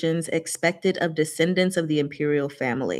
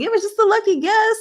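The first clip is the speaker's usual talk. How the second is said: high mock voice; 5 dB louder than usual. Retold character quote, high pitch.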